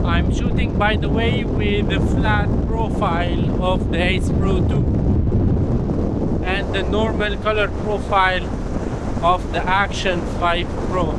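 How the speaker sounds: pitched high, pushing through wind; shouting over wind. Real, not acted. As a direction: speak up over the gusts. Outdoor clip, strong wind continues.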